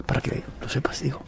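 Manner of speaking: teasing whispered aside. Pretend. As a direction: drop to a secret whisper. Aparte juguetón tras la broma. (susurro pícaro)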